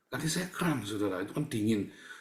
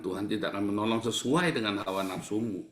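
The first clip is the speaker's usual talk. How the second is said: usual speaking voice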